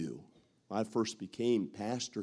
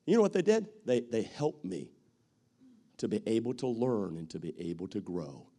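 usual speaking voice

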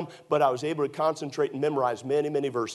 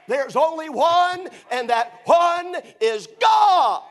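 rising to a slow, loud, high shout; preaching at full force; 9 semitones higher, 6 dB louder than usual. Acted. Preacher building to a shouted climax for the congregation. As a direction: build the sermon to a roaring climax.

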